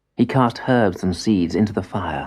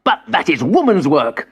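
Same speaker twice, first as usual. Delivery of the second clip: higher, sharp angry retort; snarled; 7 semitones higher, 4 dB louder than usual. Acted. Boy snarls at grandfather, higher and angry. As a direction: snap it back with contempt. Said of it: "snarled Madubi".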